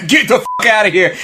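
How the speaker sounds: high, fast, laughing brush-off; laughing gangster dismissal. Retold or imitated. Henry laughing off Tommy's threat at the table, fast and high. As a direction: toss it off like it's all a joke. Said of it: "he sits back and he's like".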